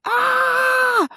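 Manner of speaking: put-on high, sudden childish wail of alarm; imitating a scared kid's cry; child's cry. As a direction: yelp like a startled toddler. Rowan starts crying, child's cry.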